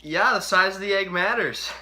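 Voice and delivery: loud, high victorious yell; triumphant shout. Real, not acted. Triumphant shout after egg squeeze.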